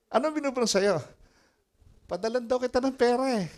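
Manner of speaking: high, loud excited exchange; rising eagerness on the phone. Retold or imitated. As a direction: pastor hearing about money being sent. Excited phone dialogue retold.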